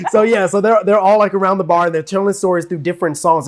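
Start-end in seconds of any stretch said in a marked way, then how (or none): none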